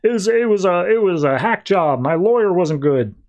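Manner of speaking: hammed-up villain pleading innocence; whiny excuse. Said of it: "And Mangubat's like".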